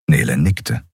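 ordinary delivery

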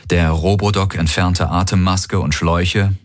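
usual speaking voice

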